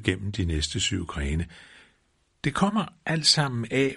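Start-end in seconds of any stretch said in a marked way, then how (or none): 2.4-3.9 high, emphatic pronouncement mid-fall; firmly concluding. A narrator's reading. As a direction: state it as a firm conclusion, pitched high. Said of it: "fastslog han". (fastslående, i højt toneleje)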